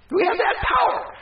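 high, shouted declaration; triumphant shout. Real, not acted. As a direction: cry out in triumph.

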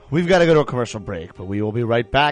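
radio host. Acted.

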